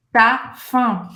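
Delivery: "tá fã" said as two separate syllables; u cut out. Shown as a demonstration. Teacher repeating the real-life form, "tá fã" without the u. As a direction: drop the u, leaving "tá" then "fã". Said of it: "Eles vão cortar esse u de tu".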